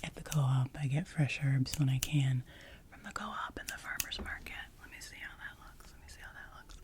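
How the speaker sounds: ordinary talk, nothing marked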